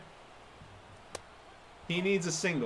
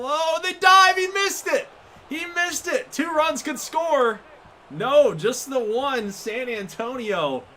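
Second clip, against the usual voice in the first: much higher and louder, yelling at the play; excited shout; 9 semitones higher, 7 dB louder than usual. Real, not acted. Gamer yells as the fielder dives and misses, excited. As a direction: shout it out as the ball gets through.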